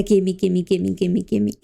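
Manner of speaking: ordinary talk, nothing marked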